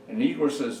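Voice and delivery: ordinary delivery, nothing marked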